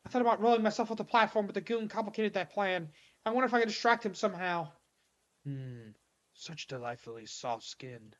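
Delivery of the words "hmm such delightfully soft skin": very low, hushed, slow creature voice; creepy creature. Acted. Creature admires its captive's skin, creepy. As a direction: savour it, low and soft.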